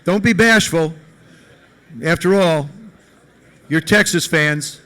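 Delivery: normal talking voice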